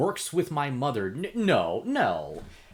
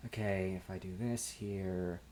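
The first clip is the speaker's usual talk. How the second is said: much softer, lower, half to himself while tinkering; muttering to himself; 5 semitones lower, 11 dB quieter than usual. Acted. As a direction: mumble it as if busy with your hands. Character tinkering on the bike.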